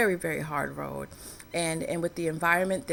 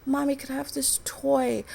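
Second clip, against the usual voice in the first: put-on kids begging; 9 semitones higher than usual. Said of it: "They're like".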